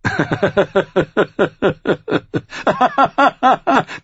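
high, long mocking laugh; outlaw's laugh. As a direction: laugh at the fool. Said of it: "Gelbmantel lachte".

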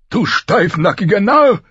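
high, loud rebuke; forceful high-voiced king; scolding king. A narrator's reading. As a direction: scold him in a big, high-pitched king's voice. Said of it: "murmelte er".

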